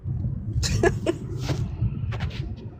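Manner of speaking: short unvoiced laugh; a real amused laugh after the rant; breathy laugh. Real, not acted. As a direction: let out a quiet breathy laugh.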